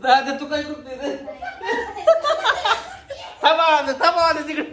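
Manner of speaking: high, giggly calling out; laughing. Real, not acted. Calling to brother during a laughing fit, giggly. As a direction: call over through real laughter.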